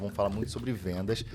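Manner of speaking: normal talking voice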